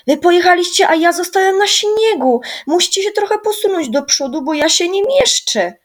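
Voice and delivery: high, loud indignant yell; left-behind boy yelling, high and loud; shouting. A narrator's reading. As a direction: shout after the departing sled. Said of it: "krzyknął Rysiek".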